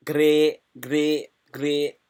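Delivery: put-on punchy Scottish accent; playful put-on Scottish accent. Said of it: "That's my Scottish. I hope you like my Scottish accent".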